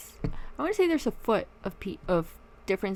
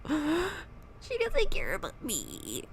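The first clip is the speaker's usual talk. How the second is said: mock crying; 5 semitones higher, much slower than usual. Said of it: "and cry".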